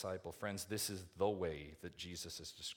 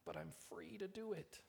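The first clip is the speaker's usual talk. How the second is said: soft, high-pitched little objection; feeble protest; 10 semitones higher, 8 dB quieter than usual. Acted. Preacher mimics believer pleading liberty, soft high protest. Same objection, repeated small.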